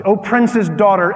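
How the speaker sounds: loud, lifted reading of the verse; preaching scripture. Acted. Scripture read aloud with force.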